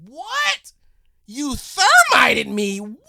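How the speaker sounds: very high, loud and slow; outraged shout of disbelief. Retold or imitated. German gunner confronting the two Americans, loud outraged disbelief. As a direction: yell it in outraged shock.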